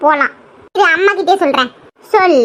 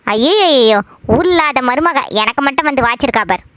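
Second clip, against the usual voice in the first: much lower, sour grumble to oneself; grumbling mother-in-law; 6 semitones lower than usual. Acted. Mother-in-law suspects the visitor, low grumbling to herself. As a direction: gripe under the household's nose in a low pitch.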